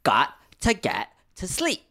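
P=A. Acted. Second reading, higher and rising.

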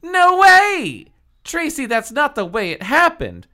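very high, loud protest; screamed denial. Acted. Halle denies Tracy's accusation, screamed denial. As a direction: scream the denial, then keep pleading high. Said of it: "Halle screamed".

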